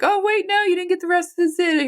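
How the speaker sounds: high-pitched voice for a nagging inner thought; mock interruption. Voicing her emotions interrupting her.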